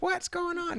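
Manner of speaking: high mock confusion; lightly imitated bewilderment. Darren still talking as she hangs up, high mock confusion. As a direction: pitch the question up, as if left hanging. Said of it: "he's still talking".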